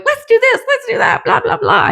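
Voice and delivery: high, hyped mock take-charge chatter; pushy knight. The knight barging in on Scorpio, mock hyped-up. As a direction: rattle it off like an overeager know-it-all. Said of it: "dude's coming in going".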